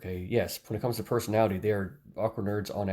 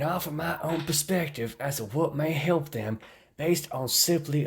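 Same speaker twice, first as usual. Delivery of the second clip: funny Southern voice; 6 semitones higher than usual. Pretend. Reading a critic's comment aloud, funny Southern voice. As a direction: read it out in a silly, high Southern accent. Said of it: "I'm gonna do a funny voice".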